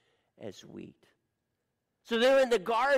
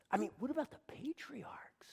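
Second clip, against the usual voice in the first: hushed, breathless disciple's question; unvoiced whispered worry; 3 semitones higher, 10 dB quieter than usual. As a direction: whisper it like a doubting aside.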